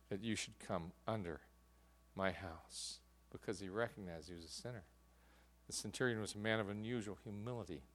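low, soft, sober delivery; quiet, grave reflection from the pulpit; hushed reflective preaching. Real, not acted. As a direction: let the voice sink low and quiet, humbly.

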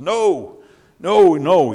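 high, loud insistent denial; David to the soldiers, refusing to be dismissed; young David protesting. Acted. David answers the doubting soldiers.

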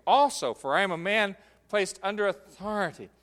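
forceful reading with "Also" stressed at the start; ALSO emphasized up front. Shown as a demonstration. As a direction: lead with a high, loud "Also". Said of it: "it emphasizes the word".

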